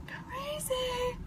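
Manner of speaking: hushed, drawn-out whisper; very soft whispered exclamation; whispered aside. Real, not acted. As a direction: whisper it like a little secret. Whispered aside on her method.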